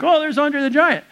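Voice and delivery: high-pitched mock excitement; excited spotting. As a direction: call it out like spotting a celebrity. Acting spotting a famous wrestler.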